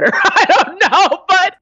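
embarrassed giggle; laughing. Real, not acted. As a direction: laugh the words out. Laughing about boss's wife.

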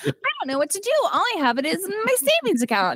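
high, helpless fake-clueless voice; playing dumb. Barbie faking money cluelessness to Ken, high helpless voice. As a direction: sound lost and helpless on purpose. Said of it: "Pretend you don't know anything about money".

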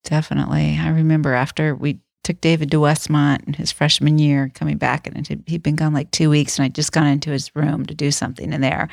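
ordinary talk

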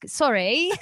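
lightly put-on Australian lilt, rising at the end; playful hint of Australian accent; mock Australian accent. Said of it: "with a guy from Australia".

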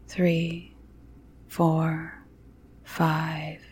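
slow soft counting whisper; calm grounding exercise. A narrator's reading. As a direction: count the breaths gently and slowly. First counted breath in.

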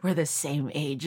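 low, soft and slow, imitating sister; hushed punchline. Retelling sister's reveal about an old classmate, mock-grave and hushed. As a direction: drop it quietly like a grave reveal.

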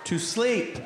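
exasperated, drawn-out complaint; slow, put-upon whine about sleep; annoyed. Retold or imitated. Husband woken at night, exasperated whine.

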